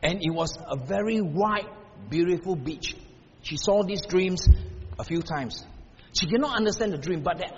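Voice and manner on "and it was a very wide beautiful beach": ordinary talk, nothing marked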